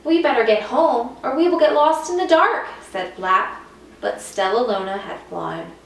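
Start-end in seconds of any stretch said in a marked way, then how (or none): 0.0-2.7 high, louder, fast urgent plea; worried little bird. Acted. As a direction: hurry them home. Worried bird urges home.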